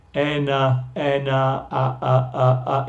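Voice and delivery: soft, lax "a" sounds repeated; schwa isolation. Shown as a demonstration. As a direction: pull out the weak middle vowel. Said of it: "Es el segundo vocal".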